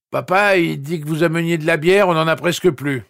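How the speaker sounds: put-on dopey young guy calling over; slurred voice. A narrator's reading. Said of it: "Voix pâteuse".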